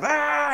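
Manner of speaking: high mock wail of a toddler; imitated tantrum scream. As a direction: mimic a little boy flipping out, high-pitched. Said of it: "And he's flipping out".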